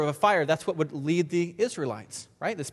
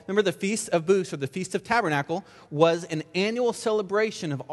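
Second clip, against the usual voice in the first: usual speaking voice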